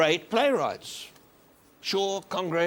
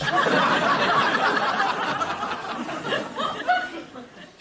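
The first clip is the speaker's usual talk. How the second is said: long falling laugh that rolls on; real laughter that keeps going. Real, not acted. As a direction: give in to a long fit of laughing. Laughs at the blind woman story.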